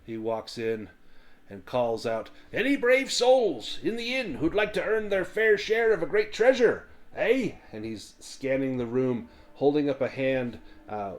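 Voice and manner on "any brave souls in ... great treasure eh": high, loud hearty dwarf calling out; rally the tavern like a gruff showman; boisterous dwarf recruiter. Acted. Dwarf calling to the inn for brave souls, high and loud.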